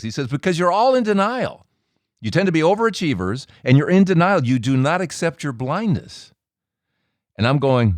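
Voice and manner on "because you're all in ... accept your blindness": blunt accusation. Retold or imitated. Quotes friend explaining the blunt nickname.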